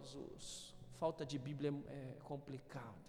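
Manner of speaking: unvoiced dismayed murmur; exasperated hush. Real, not acted. Pastor lamenting lack of Bible knowledge, soft dismay. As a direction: sigh it out under your breath.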